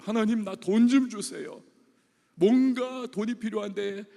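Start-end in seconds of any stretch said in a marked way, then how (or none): 0.0-1.6 pleading to God. Retold or imitated. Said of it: "돈이 지금 막 딸리는 거예요".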